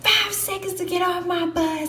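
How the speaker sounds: high, barked drill-instructor order; yelling. Retold or imitated. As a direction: bark it at the recruits. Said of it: "yelling, yelling, yelling".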